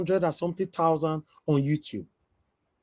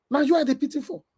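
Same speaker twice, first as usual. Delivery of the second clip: Na you I dey pity for said higher, stressing you; raised repeat; 9 semitones higher, faster than usual. Real, not acted. Scene: vlogger telling the critic he pities him, higher stressed repeat. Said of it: "repeat for emphasis".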